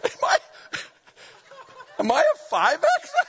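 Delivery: very high laughing question; laughing squeal. Real, not acted. Pastor joking about his size, laughing high.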